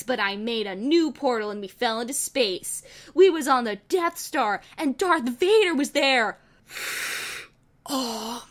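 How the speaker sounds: ordinary delivery, nothing marked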